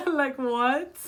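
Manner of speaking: high giggly laugh. Real, not acted. Amused at her own Jamaican impression, high laughter. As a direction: crack up at yourself.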